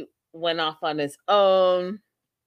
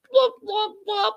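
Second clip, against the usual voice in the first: high popping blop noises with the lips; 4 dB louder than usual. Retold or imitated. Imitating Darcy's weird lip sounds in her skit, high lip pops. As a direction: pop the lips in a silly high squeak. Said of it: "she does this weird thing with her lips".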